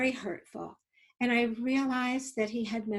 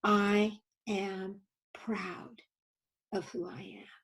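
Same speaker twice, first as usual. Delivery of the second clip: slow, halting affirmation; each word placed slowly and carefully; 3 dB quieter, slower than usual. Retold or imitated. Young man slowly affirming himself after throwing words away, very slow. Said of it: "he did very slowly".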